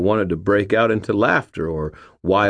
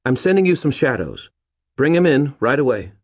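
brisk; 9 semitones higher, 5 dB louder than usual. A narrator's reading. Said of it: "her voice brightening".

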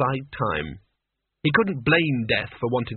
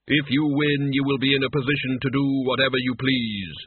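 measured, formal Death. Acted. Death giving Mort the terms, solemn and formal.

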